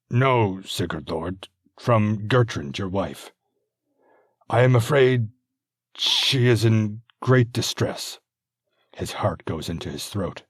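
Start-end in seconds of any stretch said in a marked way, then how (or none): 0.1-8.2 lightly voiced anxious messenger, slow and careful; a hint of a worried herald breaking bad news; nervous messenger. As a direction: messenger carefully reporting the wife's distress.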